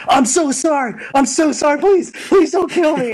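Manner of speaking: very high, panicked begging; hammed-up frantic apology; mock pleading. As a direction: play a terrified thug begging for mercy. Thug begs for life in story.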